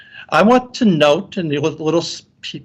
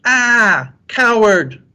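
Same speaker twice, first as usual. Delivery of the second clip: mock scream; 8 semitones higher, 4 dB louder, much slower than usual. Re-enacts the story's screamed statements.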